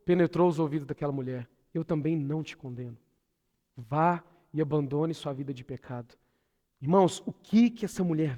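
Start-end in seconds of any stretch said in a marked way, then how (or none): 1.7-6.2 sweet, compassionate voice. Acted. Preacher voices Jesus forgiving the woman, sweet and gentle. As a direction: say it tenderly and quietly, with compassion. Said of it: "E aquela voz doce".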